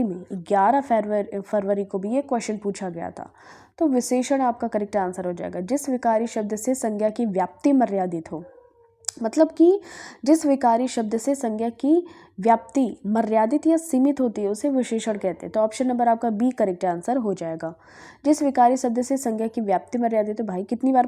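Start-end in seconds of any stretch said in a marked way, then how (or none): none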